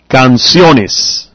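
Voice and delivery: Canciones stressed on the O, next-to-last syllable. Shown as a demonstration. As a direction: demonstrate Canciones with the weight on the O. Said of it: "Still the stress on the O".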